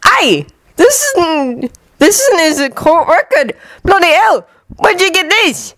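high, slow, astonished customer; startled pub wizard; surprised wizard voice. Acted. Customer reads the record.

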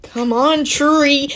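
high, pleading come on; mock exasperation at a tree; impatient at tree. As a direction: urge the tree on, pitch way up.

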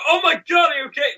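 very high-pitched alarmed cry; hammed-up panicked concern, squeaking; mock panic. Onlooker panicking over friend laughing at a wall, mock panic.

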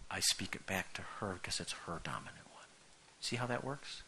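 whispered point to the congregation. Real, not acted. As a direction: confide it under the breath.

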